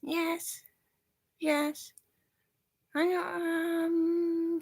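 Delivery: put-on very high small child saying yes; mother voicing her toddler daughter; child's voice. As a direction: squeak like a toddler answering mom.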